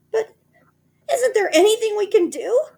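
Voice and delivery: very high, very loud terror; panicked old wife; high loud terrified cry; frightened cry. A narrator's reading. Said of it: "cried Missus Twit".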